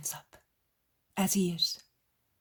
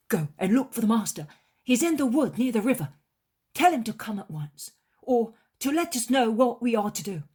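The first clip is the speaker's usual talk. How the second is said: quick, raised, louder instructions; urgent sending; 6 semitones higher, 7 dB louder than usual. Acted. Peter sends John to find the master, urgent instruction. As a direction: send him off in a hurry, pitch up.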